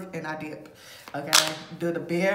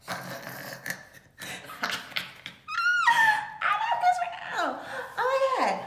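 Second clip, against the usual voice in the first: squealing high through laughter; giggly squeal. Real, not acted. Fan gushing about her crush, squealing through laughter.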